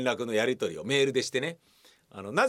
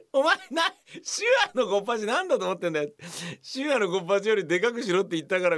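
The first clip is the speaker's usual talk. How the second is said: higher, quick mock telling-off; mock scolding; 6 semitones higher, faster than usual. Mock scolding of the actor over the size. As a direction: scold him, half laughing.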